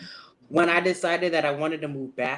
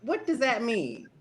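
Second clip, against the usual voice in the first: much higher, stunned disbelief; baffled, can't believe what she heard; shocked disbelief; 10 semitones higher than usual. Retold or imitated. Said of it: "I'm like".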